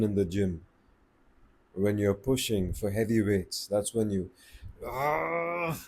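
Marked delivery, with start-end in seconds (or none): 4.8-5.8 short strained lifting grunt; gym grunt. Shown as a demonstration. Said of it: "grunt and breathe".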